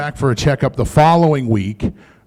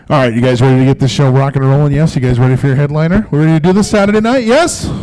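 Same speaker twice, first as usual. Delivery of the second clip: fast, louder hype to the audience; hype-man crowd call; 4 dB louder, faster than usual. Real, not acted. Comedian to Saturday crowd, fast hype call. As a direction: pump the crowd up like an MC. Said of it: "hyping crowd for headliner".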